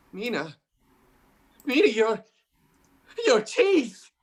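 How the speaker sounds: loud startled no; horrified cry. Acted. John cries out on seeing Mina's teeth. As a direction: recoil in horror. Sees vampire teeth in terror.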